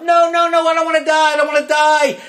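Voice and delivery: high, loud, cowardly wail; hammed-up terrified crying out. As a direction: plead for your life like a screaming little kid. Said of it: "he snivels and he cowers and he cries out".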